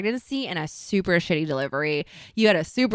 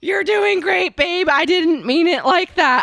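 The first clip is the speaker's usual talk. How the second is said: husband backpedaling; 5 semitones higher, 3 dB louder, slower than usual. Retold or imitated. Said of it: "And he goes".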